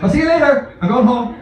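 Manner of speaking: mock exit line. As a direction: bail out of the gym, laughing. Quoting himself fleeing gym.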